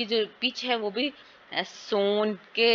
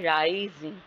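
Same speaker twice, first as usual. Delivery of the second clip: "Rising" with the राइ vowel made prominent; stress on first syllable; slower than usual. Shown as a demonstration. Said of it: "ये ज़्यादा prominent है".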